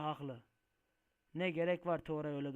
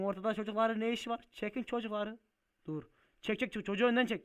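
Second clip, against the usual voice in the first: high, raised-voice warning; urgent calling out; 6 semitones higher, 6 dB louder than usual. Acted. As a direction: call out to clear the way. Çocukları yoldan çektirmek için sesleniyor. (telaşla yüksek sesle seslenerek)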